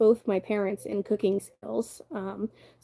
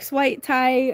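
sweet tea drawled slowly in a Southern accent, sweet stretched long like swaaait; slow Southern drawl on sweet tea, vowel pulled wide; 3 semitones higher than usual. Shown as a demonstration. Streamer showing chat how Southerners say sweet tea, Southern drawl. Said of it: "you gotta say it with the tongue".

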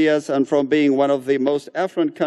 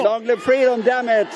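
raised pitch rally shout; rally cry; 7 semitones higher than usual. Acted. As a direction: echo the chant up high. Interpreter echoing rally chant. (grito de mitin)

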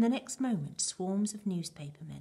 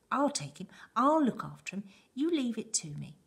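loud, higher, insistent volunteering; excited, raised-voice insistence; eager shouting; 5 semitones higher, 6 dB louder than usual. A narrator's reading. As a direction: burst out eagerly, pitched up. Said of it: "shouted Grandpa Joe".

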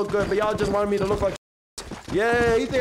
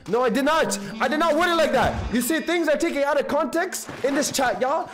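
higher-pitched, defensive denial; defensive, raised pitch; indignant protest; 6 semitones higher than usual. Real, not acted. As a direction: protest your innocence to the chat. Defending himself against chat's accusation.

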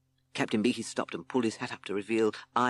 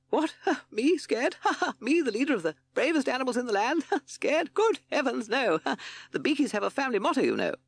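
high-pitched bluster broken by nervous laughs; nervous laughter; 4 dB louder than usual. A narrator's reading. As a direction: laugh off the fear as a boastful captain. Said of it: "gave a nervous laugh".

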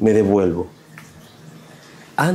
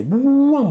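a loud, high "buam" crash noise; imitated crash boom; 8 dB louder than usual. Telling a friend how the patrol bike crashed, loud mimicked boom. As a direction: burst out with the bang of the bike hitting. Motorcycle crash in retold chase.

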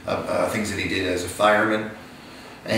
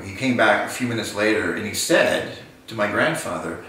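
ordinary delivery

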